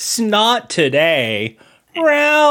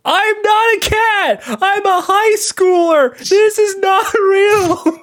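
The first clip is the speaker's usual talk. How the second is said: panicked scream. Acted. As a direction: scream in terror. Screaming kid trapped as cat.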